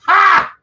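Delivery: loud, very high taunting "Ha!"; a single loud mocking ha; mocking laugh. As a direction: bark out a scornful laugh. Mocking the collector's lowball offer.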